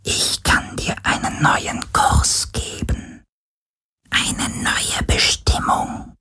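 slow ghostly whisper; eerie plaintive whisper. Acted. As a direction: lure him with a haunting whisper. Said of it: "Eine schöne, klagende Stimme". (klagendes Geisterflüstern)

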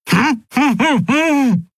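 very high wordless "hm" noises; urgent high closed-mouth squeals; panicked humming. A narrator's reading. Captive captain tries to instruct the henchmen, panicked hums. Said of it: "sagte Zaylar panisch".

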